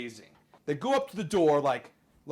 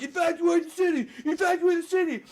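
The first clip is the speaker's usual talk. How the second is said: high, loud frantic alarm call; panicked shouting; 5 dB louder than usual. Acted. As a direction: yell the order in terror. Panicked citizens under bombing.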